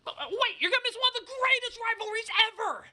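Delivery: high, hurried wait-don't-go; urgent plea. Real, not acted. Begging his co-host to stay for the rivalry, high and urgent. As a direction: call him back before he leaves.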